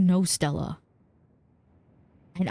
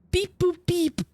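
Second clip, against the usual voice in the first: beeping noises; 12 semitones higher, slower than usual. Pretend. Mimicking phone noises.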